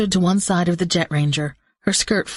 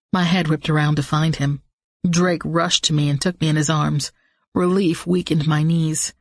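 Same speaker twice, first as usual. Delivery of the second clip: plain reading voice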